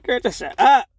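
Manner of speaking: loud, high, exasperated; annoyed shut up. Retold or imitated. Viewers fed up with the midwife, loud exasperated snap. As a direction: snap it at her like you've had enough. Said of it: "me and Nina was annoyed at her ass".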